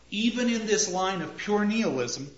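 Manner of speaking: ordinary talk, nothing marked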